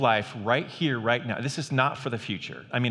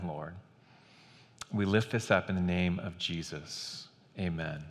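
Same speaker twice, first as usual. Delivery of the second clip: hushed, softer prayer voice; 3 semitones lower, 4 dB quieter than usual. Acted. Pastor praying aloud for the congregation, hushed and reverent. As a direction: pray quietly and reverently over them.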